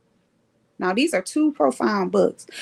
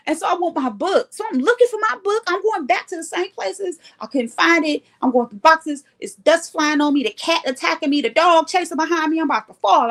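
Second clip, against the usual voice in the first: higher, frantic run-on recounting; frantic retelling; 6 semitones higher than usual. Retold or imitated. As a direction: act out a mounting, hapless search. Acts out frantic search for book.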